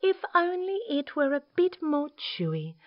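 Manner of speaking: high, wistful emu; sighing. Acted. Emu finding fault with the stew, high sighing voice. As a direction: wish it aloud in a high sigh. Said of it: "she sighed".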